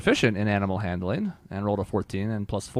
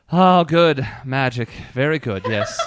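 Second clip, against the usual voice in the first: tinge of disappointment; 3 semitones higher, 4 dB louder, slower than usual. Acted. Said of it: "tinge of disappointment".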